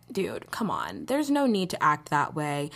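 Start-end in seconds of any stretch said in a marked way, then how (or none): 0.1-1.1 exasperated whisper; whispered plea. Real, not acted. Vlogger scolding viewers, exasperated whisper. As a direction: breathe it out in a whisper.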